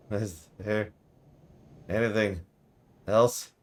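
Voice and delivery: normal talking voice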